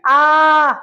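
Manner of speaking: आ held long and loud; long drawn-out vowel. Shown as a demonstration. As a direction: stretch the आ out long. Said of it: "खींच रहा है लंबा".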